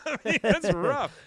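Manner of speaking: laughing. Real, not acted. Laughing reaction.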